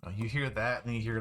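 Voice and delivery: usual speaking voice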